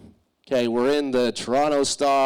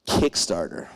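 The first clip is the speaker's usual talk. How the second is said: deadpan answer; 9 semitones lower, slower than usual. Retold or imitated. Lior's deadpan suggestion.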